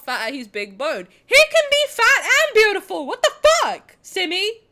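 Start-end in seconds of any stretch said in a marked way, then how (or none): 1.3-3.1 high, loud outraged yell; defensive shout. Real, not acted. Shouting at Simi in defence of the fat spider, loud. 3.1-4.6 high, loud what-the-fuck; outraged disbelief. Real, not acted. Indignant at Simi over spider talk, loud disbelief.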